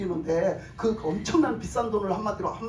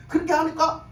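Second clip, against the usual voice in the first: ordinary delivery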